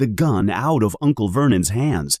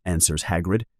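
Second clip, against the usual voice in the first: usual speaking voice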